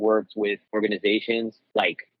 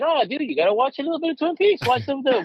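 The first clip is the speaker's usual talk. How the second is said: high-pitched, retold pleading with a buddy; eager coaxing; 13 semitones higher, faster than usual. Host luring his friend to stay for Twin Peaks, eager coaxing. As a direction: coax him in a higher voice, as if replaying it.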